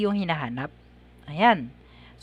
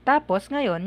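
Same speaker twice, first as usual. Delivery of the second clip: ordinary talk, nothing marked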